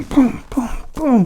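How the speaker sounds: usual speaking voice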